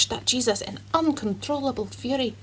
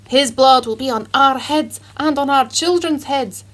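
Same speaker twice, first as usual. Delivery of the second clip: louder, heated cry; leaders screaming; 3 semitones higher, 7 dB louder than usual. A narrator's reading. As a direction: scream it at the governor.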